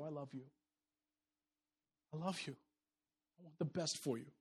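tender love. Acted. Loving talk to others, soft.

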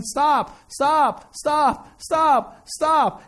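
insistent STOP, punched over and over; repeated warning. Acted. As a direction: hit every STOP evenly, falling tone each time. Acts God's repeated warnings.

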